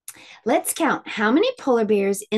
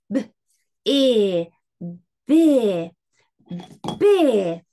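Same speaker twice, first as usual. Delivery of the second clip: normal talking voice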